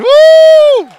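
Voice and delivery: long high whoop of celebration; host whooping for the winner; loud high cheer. Real, not acted. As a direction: whoop it out to the room.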